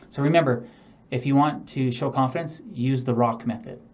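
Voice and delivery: normal talking voice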